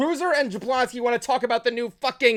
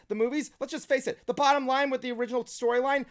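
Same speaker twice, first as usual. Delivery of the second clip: ordinary delivery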